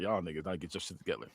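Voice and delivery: low, quiet letdown; disappointed. Retold or imitated. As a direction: mutter it low, kinda disappointed. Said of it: "kinda disappointed".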